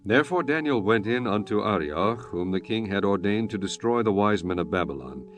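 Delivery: ordinary talk, nothing marked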